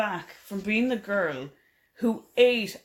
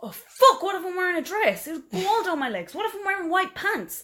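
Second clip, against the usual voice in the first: mock panic; 10 semitones higher than usual. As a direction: squeal it out in playful horror. Panicked imagining dress accident.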